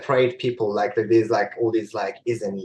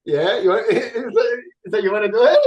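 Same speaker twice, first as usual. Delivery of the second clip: high, laughing incredulous reply; laughing mock outrage; 12 semitones higher, 6 dB louder, faster than usual. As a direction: squeal it through laughter, playfully shocked.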